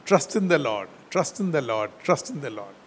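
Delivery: a fading echo of the command. Shown as a demonstration. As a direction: let each repeat sink down and fade away. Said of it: "It is a standing instruction".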